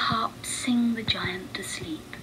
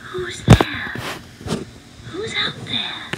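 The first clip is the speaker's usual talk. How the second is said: higher, gentle calling voice; gentle harp voice; 8 semitones higher, slower than usual. Acted. The caged harp calls to the hidden visitors, gently. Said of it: "when a gentle voice asked".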